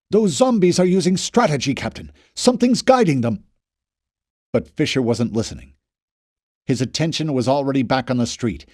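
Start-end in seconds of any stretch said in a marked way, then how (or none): 0.1-3.4 urgent warning. A narrator's reading. Knopf urgently warning the captain.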